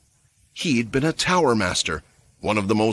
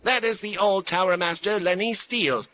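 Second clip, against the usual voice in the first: high, firm announcement; stern woman announcing; 9 semitones higher, 4 dB quieter than usual. A narrator's reading. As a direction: proclaim who he is. Mia announces Lenny's identity.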